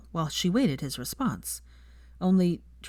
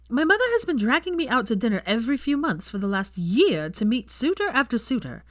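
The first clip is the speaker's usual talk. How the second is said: angry rant; 6 semitones higher, 3 dB louder than usual. A narrator's reading. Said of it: "angrily waving the vial".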